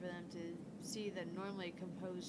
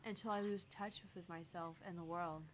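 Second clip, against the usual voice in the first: plain reading voice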